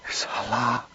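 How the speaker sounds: soft, pained calling of her name; husband saying her name in anguish. A narrator's reading. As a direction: say her name like it breaks you. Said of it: "said in a tone that brought her heart to her mouth".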